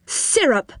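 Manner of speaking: crisp storybook command; firm voice. A narrator's reading. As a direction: order it firmly, as one word. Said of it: "in a firm voice".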